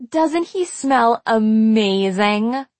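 drawn-out swooning gush. A narrator's reading. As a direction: gush slowly, savoring the scent. Marcy swoons over boy's cap.

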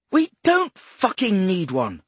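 high, loud bark on don't. Acted. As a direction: snap back, hitting don't hard. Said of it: "she barked".

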